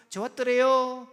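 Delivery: high, loud Gangwon dialect punchline; comic sing-out in a Gangwon accent. Retold or imitated. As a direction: imitate a Gangwon local, lifting the ending. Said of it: "그 강원도에서 어떻게 하시죠".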